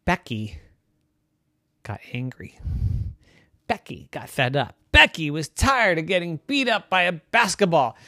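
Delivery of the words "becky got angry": hushed whisper for suspense; spooky story whisper. A narrator's reading. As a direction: breathe it out as a secret. Dramatic pause in bedtime story.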